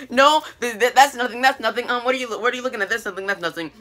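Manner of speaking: high, stammered mock cover-up; flustered denial. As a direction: act out a hasty, repetitive brush-off. Retelling a girl hiding a secret.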